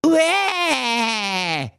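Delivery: high comic monster roar; mock scary roar. A narrator's reading. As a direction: roar to scare the prince. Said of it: "mit furchterregender Stimme".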